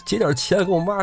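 high-pitched, stumbling excuses; acted sob-story from a cornered robber; desperate pleading. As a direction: plead up high, repeating yourself as you invent. Robber improvises excuse to be spared.

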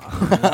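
short burst of laughter; real laugh. Real, not acted. Laughing at the scene.

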